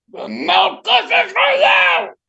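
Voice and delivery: loud, slow, high frog voice; taunting frog. Acted. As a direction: draw out the frog's refusal loudly. Frog threatens to withhold kisses.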